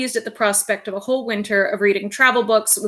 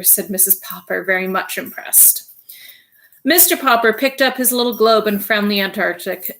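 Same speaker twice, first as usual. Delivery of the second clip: ordinary delivery, nothing marked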